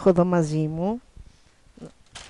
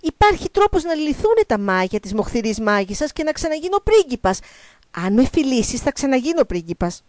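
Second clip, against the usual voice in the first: urgent, high-pitched explaining; eager pleading frog; 5 semitones higher, 4 dB louder than usual. Acted. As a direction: win her over before she leaves. (με αγωνία και ψιλή φωνή)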